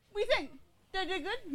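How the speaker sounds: high, soft puppy voice asking for praise; eager dog voice. Retold or imitated. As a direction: play the proud dog hoping to be told it did well.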